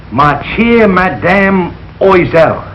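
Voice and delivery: "Ma chère Madame Oisel" read slowly, word by word, with clumsy American sounds; butchered French. Acted. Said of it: "I know why you flunked French".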